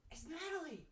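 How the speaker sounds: high, loud delighted squeal; thrilled to see an old friend; excited. Acted. NPC recognizes old friend.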